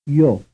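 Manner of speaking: one syllable; glide plus vowel. Shown as a demonstration. Teacher quizzing students. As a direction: slide y into the vowel. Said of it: "semivowel plus vowel".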